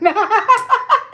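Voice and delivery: a burst of high laughter. Real, not acted. Cracking up while warning viewers, high laugh.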